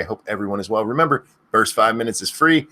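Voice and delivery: ordinary talk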